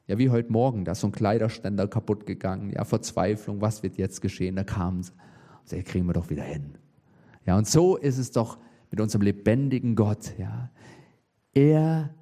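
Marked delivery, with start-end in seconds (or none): 5.7-6.8 reassuring dad. Retold or imitated. Father reassuring daughters, retold.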